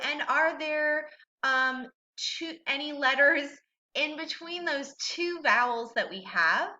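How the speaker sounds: usual speaking voice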